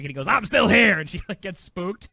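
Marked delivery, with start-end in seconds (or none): 0.3-1.0 loud, high mock-menacing shout; sudden spooky imitation of a lurking crook; criminal voice. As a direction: burst out like a movie blackmailer. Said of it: "he does this criminal voice".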